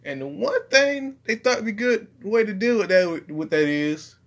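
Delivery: high, put-on voice; character voice. Shown as a demonstration. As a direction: keep up the character. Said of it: "I'm just gonna talk in his voice".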